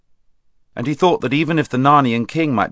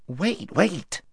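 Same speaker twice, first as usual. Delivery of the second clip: impatient; 8 semitones higher, 4 dB quieter than usual. Acted. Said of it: "said Mister Tumnus impatiently".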